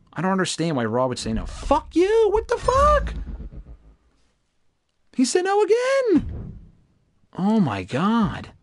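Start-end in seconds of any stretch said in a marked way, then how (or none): 1.4-3.1 real gamer rage; furious outburst. Real, not acted. Trade rejected, raging at game. 5.1-8.5 high, slow, stretched-out outrage; real exasperation; outraged disbelief. Real, not acted. Trade rejected again.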